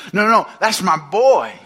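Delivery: proud father. Acted. God says it of his Son, proud and emphatic. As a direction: claim him proudly, pitch lifted.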